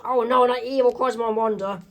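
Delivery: high acted cry of alarm; startled, high-pitched cartoon voice; shocked yell. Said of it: "is shocked and yells".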